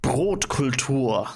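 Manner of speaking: slow, savoured German word; deliberate German enunciation; relished German pronunciation. Shown as a demonstration. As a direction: draw it out in a German accent. Savours the German word just heard.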